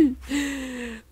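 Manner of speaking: sudden breathy gasp of alarm; startled gasp at the boss; sharp gasp. Real, not acted. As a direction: catch your breath in shock. Boss fight, nearly dying.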